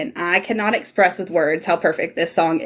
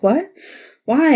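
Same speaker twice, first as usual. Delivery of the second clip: rising, louder mock surprise; a retold baffled what, why; confused what; 6 semitones higher, 4 dB louder than usual. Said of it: "He's like".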